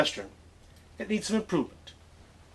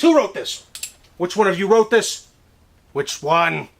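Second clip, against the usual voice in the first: high, loud furious demand to know who wrote it; angry demand; 5 semitones higher, 7 dB louder than usual. Acted. Teacher confronting his class over graffiti, furious. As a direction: bark at the class, outraged.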